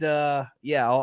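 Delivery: normal talking voice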